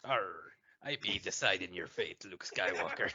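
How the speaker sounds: a light pirate growl; hammy pirate talk, laughing at the end. Pretend. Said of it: "not ready for honeys in a pirate voice".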